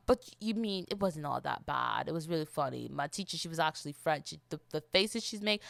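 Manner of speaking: normal talking voice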